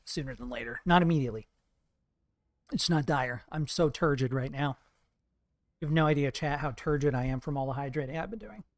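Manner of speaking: ordinary delivery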